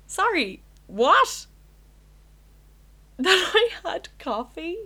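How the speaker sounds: high, loud disbelief with a laugh; shocked and offended, voice jumping up; incredulous. Real, not acted. Outraged reply to teacher.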